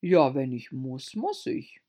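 a touch of gloomy resignation; understated mournful king; melancholy. A narrator's reading. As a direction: sigh it out reluctantly. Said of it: "in melancholischem Ton".

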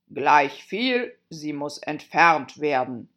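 decide it like a stubborn king; decisive king. A narrator's reading. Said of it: "sagte der König sehr entschieden".

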